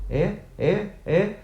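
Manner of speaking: high questioning calls, each rising further; interjected shout. A narrator's reading. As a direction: cut in with climbing, insistent queries. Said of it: "Y el señor Shears gritó".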